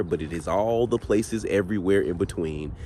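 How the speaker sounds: ordinary talk, nothing marked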